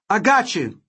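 high-pitched excited call; triumphant cry. A narrator's reading. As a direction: call it out high over the comms. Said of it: "Wody cried".

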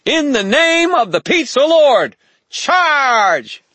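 very high, loud tiny war cry; shrill battle charge from a fairy. A narrator's reading. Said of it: "voice shrill".

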